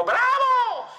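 very high, stretched cheer; crowd shout. Acted. Crowd cheers the teller's pass, high drawn-out shout. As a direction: shout it like the stands erupting. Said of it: "la gente le gritó".

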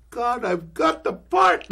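high, slow, tearful cry of triumph; a choked, delighted thought said aloud; inner triumph. Retold or imitated. Actor realizing he won the part, high and tearful. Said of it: "inwardly I said".

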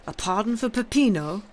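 loud, high, incredulous question; yelled disbelief. A narrator's reading. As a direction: yell it as an outraged question. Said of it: "yelled Andrea".

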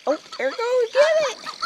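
very high, rising excitement; excited egging-on. Real, not acted. Owner sending dog after toy, excited.

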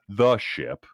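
"the ship", hit "the" hard to correct her; pointed "the ship", leaning on "the"; pointed correction. Acted.

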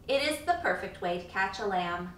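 ordinary delivery

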